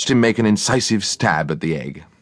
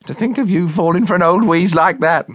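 high-pitched, crowing cook voice; gleeful cook; 13 semitones higher, 4 dB louder than usual. A narrator's reading. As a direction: tease him for falling for the prank. Cook crows over successful prank.

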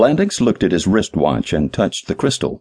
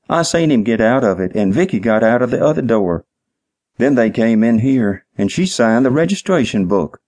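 higher-pitched, simple-minded witness, eagerly recounting; dim-witted night manager; 5 semitones higher than usual. Acted. Clerk tells detective about the car, dim-witted and earnest.

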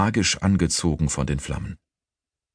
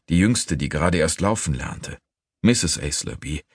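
ordinary delivery, nothing marked

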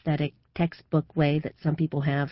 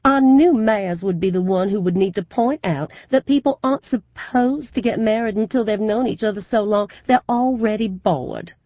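gushing southern belle; 7 semitones higher, 6 dB louder than usual. A narrator's reading. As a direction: play the Southern belle for the crowd. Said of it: "Then the wheedling voice starts".

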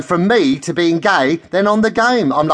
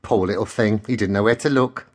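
low, softer aside; mock pity; 7 semitones lower, 3 dB quieter than usual. A narrator's reading. Gossip recalling the man she teased, low mock pity. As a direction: drop down low in mock sympathy.

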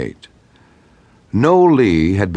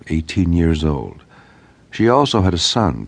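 ordinary delivery, nothing marked

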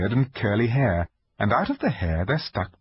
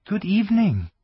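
put-on young girl voice; 11 semitones higher, 4 dB louder than usual. Lucy greets the fawn.

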